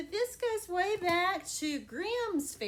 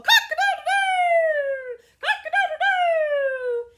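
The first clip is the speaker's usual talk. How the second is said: much higher, loud crowing rooster; 12 semitones higher, 14 dB louder, slower than usual. Acted. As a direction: crow it out like a frantic rooster. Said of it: "he just kept on going".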